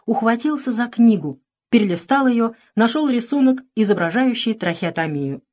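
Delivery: ordinary delivery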